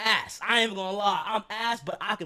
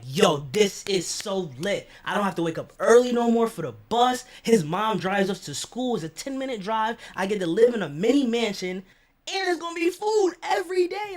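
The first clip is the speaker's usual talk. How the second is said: louder and higher, building to a shout; celebrate, ending in a yell; overjoyed; 4 semitones higher, 5 dB louder than usual. Retold or imitated. Thrilled he can stay.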